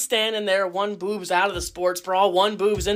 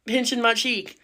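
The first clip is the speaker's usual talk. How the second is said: usual speaking voice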